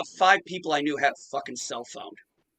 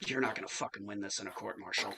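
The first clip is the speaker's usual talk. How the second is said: low, hushed warning said off to one side; quietly tip off the officer so no one hears; hushed aside; 6 semitones lower, 10 dB quieter than usual. Retold or imitated. Gunny pulls lieutenant aside privately.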